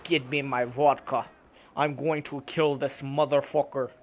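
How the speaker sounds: slow, lightly put-on bad Russian accent; mock a Russian mob boss, half-hearted; shitty Russian accent. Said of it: "That shitty Russian voice I just made".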